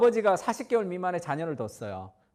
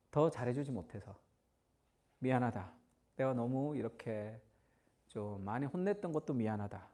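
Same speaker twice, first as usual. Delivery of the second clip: regretful apology; 6 semitones lower, 8 dB quieter than usual. Retold or imitated. Fathers apologizing to children.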